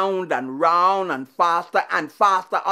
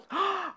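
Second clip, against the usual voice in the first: sharp gasp; 10 semitones higher, 7 dB quieter than usual. Acted. Wife shocked husband walks out.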